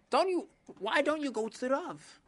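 high, scolding wife pushing her husband; wife's voice. Acted. Wife scolds Chaim for sighing, high nagging voice.

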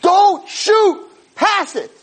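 high-pitched mock scream from the stands. Spectator yelling to the player at the game, screaming. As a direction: yell it desperately at the player. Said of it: "screaming at the top of my lungs".

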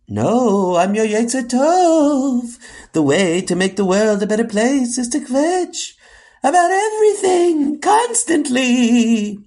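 eerie high-pitched phantom voice; spooky voice. Acted. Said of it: "not in that spooky voice".

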